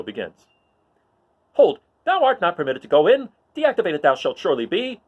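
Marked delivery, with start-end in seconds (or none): 1.5-5.0 high, loud, prissy droid scolding; high-pitched indignant protocol droid arguing; fussy C-3PO. Acted. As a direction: scold the little droid like a huffy butler. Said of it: "he's arguing with C three P O".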